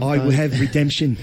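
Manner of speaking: a cocky Irish fighter swearing revenge; Conor McGregor Irish accent. Retold or imitated. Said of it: "You gotta think of Conor McGregor".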